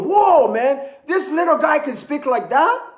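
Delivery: acted-out "whoa" of surprise; mock amazement. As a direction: exclaim in playful disbelief. Acting amazed at little guy's voice.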